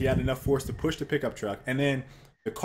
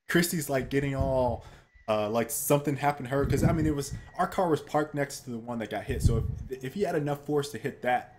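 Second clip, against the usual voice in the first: usual speaking voice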